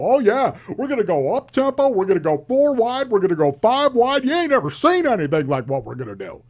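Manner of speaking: high, loud bragging sales pitch; over-the-top hype man, acted; mock hype. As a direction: pitch way up, hammed-up boasting. Acted hype of offense to fool people.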